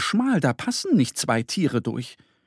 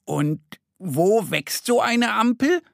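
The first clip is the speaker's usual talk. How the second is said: higher, slow wondering cub; puzzled little bear; 8 semitones higher, slower than usual. Acted. Mo wondering where traffic lights grow, puzzled. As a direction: ponder it like a curious child. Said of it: "grübelt Mo".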